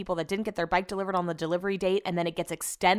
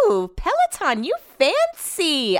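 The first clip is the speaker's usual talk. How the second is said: slow, drawn-out teasing "you fancy"; mock impressed; 7 semitones higher, slower than usual. Talking to Peloton, mock impressed and teasing. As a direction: tease them like they're showing off.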